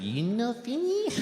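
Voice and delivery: put-on waitress asking in a high Japanese accent. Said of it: "She said".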